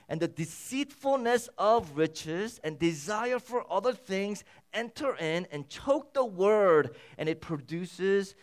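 high, loud, driving sermon voice; fervent pulpit delivery; impassioned preaching. Acted. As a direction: preach it out with full force. Preaching with rising intensity.

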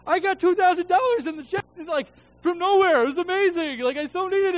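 high, loud, thrilled churchgoer; gushing testimony. Retold or imitated. Church lady announcing her two thousand dollar check, high and gushing. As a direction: burst with joy over a windfall.

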